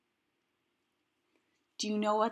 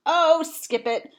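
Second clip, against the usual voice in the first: giving up; 8 semitones higher, 5 dB louder, slower than usual. Acted. Gives up in frustration.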